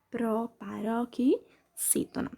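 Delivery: "Proparoxítona" slowly, broken into chunks; measured teacher's answer, "Proparoxítona" in separate pieces; syllable by syllable. Shown as a demonstration.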